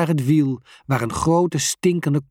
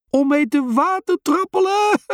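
high squealing laugh through the word; shrieking with laughter; 4 dB louder than usual. A narrator's reading. Bas shrieks with laughter about the water bike. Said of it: "giert hij".

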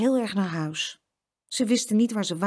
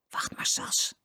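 soft conspiratorial whisper; 5 dB quieter, slower than usual. A narrator's reading. Boy secretly reassuring his sister, whispered. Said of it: "fluisterde Jeroen".

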